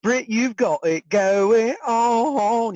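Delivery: slow, high sung Kiwi accent. Shown as a demonstration. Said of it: "let's hear it".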